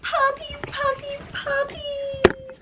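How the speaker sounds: very high-pitched puppy call; high, drawn-out call to pets; calling the puppies. Real, not acted. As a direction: squeal it out to get the dogs' attention.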